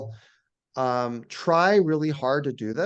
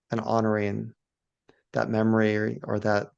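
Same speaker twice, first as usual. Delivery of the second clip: guided meditation; 2 semitones lower than usual. Shown as a demonstration. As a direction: gently guide listeners into awe, phrase by phrase. Leads a guided awe meditation.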